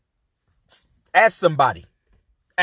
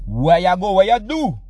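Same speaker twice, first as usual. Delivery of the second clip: put-on louder, higher Caribbean accent; Caribbean repeat; 6 semitones higher, 7 dB louder than usual. Neighbor repeats the question louder in a light Caribbean accent. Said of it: "Pardon me?".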